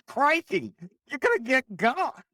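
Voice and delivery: high, loud hammed-up Australian accent; bad Australian accent. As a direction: ham up a cartoon Australian, Crikey and all. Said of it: "that's a bad Australian accent".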